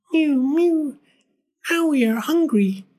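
very high, tiny kitten voice; mewing cats. A narrator's reading. Cats telling the old man they are hungry, tiny mewing voice. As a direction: mew plaintively like hungry kittens. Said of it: "said the hundreds of cats".

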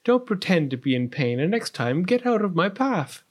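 irritated, imperious storybook princess; snapping. A narrator's reading. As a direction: scold the puppy crossly. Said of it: "snapped Prunella".

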